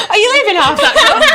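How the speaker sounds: high, loud laughing indignation; laughing mock outrage. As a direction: play the offended tea maker, cracking up. Mock offended host over tea.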